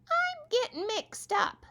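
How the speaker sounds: very high, slow, squeaky critter voice; little squirrel voice. Acted. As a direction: play a tiny confused squirrel. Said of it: "said Abe's little friend the squirrel".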